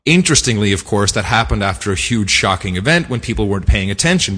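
ordinary delivery

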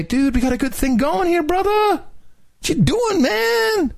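very high, whiny buddy voice; squeaky mock pleading, drawn out at the end; Barack, imitated. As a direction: plead with a pal, stretching the last words. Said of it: "Barack went down there to say".

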